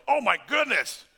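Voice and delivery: loud, high burst of amazement; acted astonished outburst; amazed realization. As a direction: exclaim it as if it suddenly dawned on you. Acts out sudden revelation.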